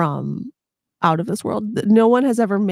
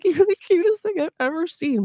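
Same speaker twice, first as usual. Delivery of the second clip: squeaky high alien cooing; crazy alien voice; 9 semitones higher than usual. Retold or imitated. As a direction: coo it like a smitten alien. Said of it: "in their crazy alien voice".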